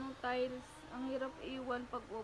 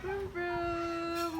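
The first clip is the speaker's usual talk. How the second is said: playful high vroom vroom; mock engine noise; 5 semitones higher, 6 dB louder than usual. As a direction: make car sounds for the baby. Mother mimics car sound for baby. (gayang tunog ng sasakyan)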